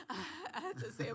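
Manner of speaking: high, loud laughing telling. Real, not acted. Guest laughing as she tells the host. Laughing recounting prayer to God.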